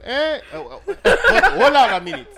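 put-on high, loud exclaiming African accent. Host mocks friend with a loud, high African accent. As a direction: shout the banana line in a hammed-up African accent. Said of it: "You sound African right now".

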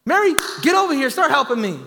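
high, loud bossy call; mock yell. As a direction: yell across the kitchen at Mary. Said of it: "Martha's yelling at me".

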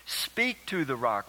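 "Speak to the rock." slow, stress landing on "Speak"; emphasized slowly. Shown as a demonstration. Pastor recalling the emphasized command, slow and stressed. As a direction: drag out "Speak" deliberately. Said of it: "pause there and emphasize that".

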